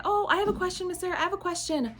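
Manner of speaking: put-on high, excited kid voice pleading to be called on; eager child. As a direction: play an over-eager kindergartner waving a hand. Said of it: "raise their hand and say".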